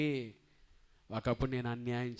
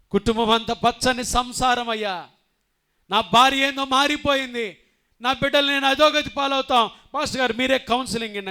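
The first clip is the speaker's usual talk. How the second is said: loud, high begging; desperate plea; 9 semitones higher, 12 dB louder than usual. Acted. Husband begging the pastor to counsel his wife. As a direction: beg the pastor for help.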